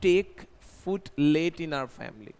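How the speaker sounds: very slow model sentence; word by word for students; slow dictation. Shown as a demonstration. (धीरे-धीरे वाक्य)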